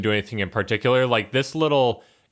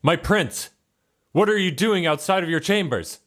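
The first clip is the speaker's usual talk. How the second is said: higher-pitched guard addressing his prince; guard's voice, raised in pitch; startled guard; 8 semitones higher than usual. Acted. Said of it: "He's like".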